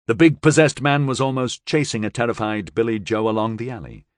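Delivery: usual speaking voice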